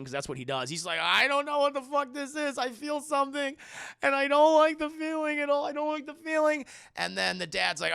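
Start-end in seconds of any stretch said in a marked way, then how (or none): 1.0-6.7 high, whiny crybaby wail; mock crying. Frightened son at the séance, mock tearful whine. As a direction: whine tearfully through every word. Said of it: "just starts fucking crying again".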